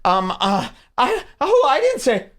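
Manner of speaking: imitating a rattled girl on the phone; flustered stammering girl. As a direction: play a caught-off-guard girl fumbling for words. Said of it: "usually a girl would be".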